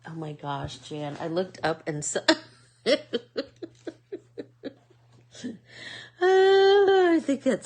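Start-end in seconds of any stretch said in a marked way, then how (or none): 2.3-7.7 squealing high through giggles; laughing. Real, not acted. Something funny catches her eye, high loud laughing. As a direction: let the laugh lift the voice way up, loud. Cracking up at something she saw.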